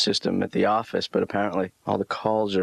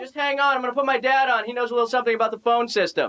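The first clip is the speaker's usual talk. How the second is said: frantic shouting at very high pitch; yelling. Acted. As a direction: yell over a bad phone line. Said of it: "Why are you yelling?".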